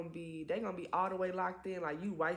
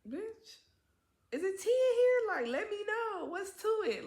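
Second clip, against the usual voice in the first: much higher, nosy, prying mock voice; nosy woman's look; 4 dB louder than usual. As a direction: voice her side-eye, high and nosy. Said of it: "she looking at me like".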